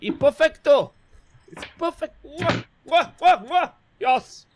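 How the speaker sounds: put-on high, loud, laughing French accent; hammy French chef, cracking up. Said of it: "That's my French".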